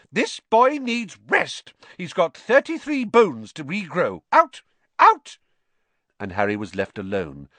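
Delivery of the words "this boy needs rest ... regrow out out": slow, much higher, building to a loud yell of Out; shouting matron. Acted. Matron chases the team from the ward, shouting louder and higher. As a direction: throw them all out of the ward. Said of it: "storming over, shouting".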